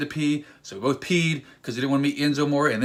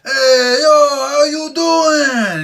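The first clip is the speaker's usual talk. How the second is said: much higher, loud, stretched-out hello; excited greeting; 13 semitones higher, 12 dB louder, much slower than usual. Retold or imitated. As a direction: greet your hero like you can't contain yourself. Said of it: "'cause I was so excited".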